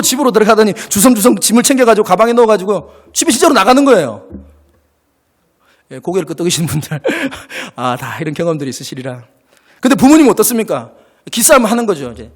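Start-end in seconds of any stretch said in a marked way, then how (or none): none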